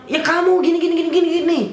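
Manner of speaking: very high-pitched, mocking babble; mock his tirade as rattled nonsense; mimicked ranting officer. Officer answering back, mocked high-pitched rant.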